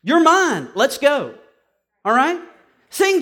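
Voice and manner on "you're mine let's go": loud, higher claim and order; commanding Jesus. Retold or imitated. Jesus claims the preacher, loud commanding voice. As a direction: claim him with authority. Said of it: "My conversion story goes like this".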